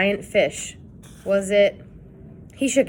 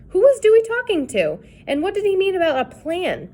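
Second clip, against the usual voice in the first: bewildered; 11 semitones higher, 4 dB louder than usual. A narrator's reading. Confused narration.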